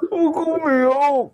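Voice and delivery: very high, drawn-out put-on voice; mock crying tease. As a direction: tease in a squeaky slow wail. Teasing the annoyed partner in put-on voice. (matinis na pang-aasar na boses)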